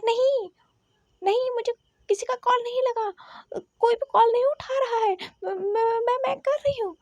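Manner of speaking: high, stammering, strained shout; panicky shouted reply. Acted. Venching shouting back that no call connects, high panicky shout. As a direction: shout back helplessly, tripping over words. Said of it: "वेनचिंग ने चिल्लाकर कहा".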